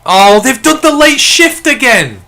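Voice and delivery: loud, high, furious yelling at the refs; real furious shouting at the screen; real angry rant. Real, not acted. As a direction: rage at the shifting penalty call. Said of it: "even I'm getting mad now".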